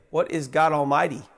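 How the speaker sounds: ordinary talk, nothing marked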